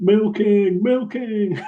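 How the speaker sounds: high, loud Aussie referee calling milking; thick Australian accent. Retold or imitated. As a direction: bark it like an Australian ref. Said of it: "in a thick Australian accent".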